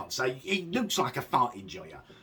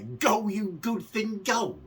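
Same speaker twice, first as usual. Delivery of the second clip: high, slow breathy imitation of the cheer; mock breathy cheer; 6 semitones higher, slower than usual. Mocking the cameo's breathy ending to the viewers, high and slow. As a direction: mimic her odd breathy send-off, higher and slower. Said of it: "she's breathing that at the end there".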